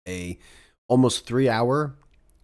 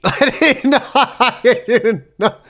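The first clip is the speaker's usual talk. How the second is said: high, loud, slow words through laughter; laughing disbelief; 10 semitones higher, 7 dB louder, much slower than usual. Real, not acted. Amazed at the debate cat news, laughing hard.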